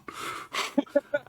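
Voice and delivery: breathy giggle; a stifled laugh; whispered laugh. Acted. After whispered guard line.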